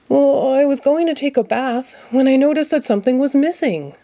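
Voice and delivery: a hint of cheerful Ernie, fond of his duck; gentle, affectionate Ernie voice; lightly voiced Ernie. A narrator's reading. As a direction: Ernie talking sweetly to his toy.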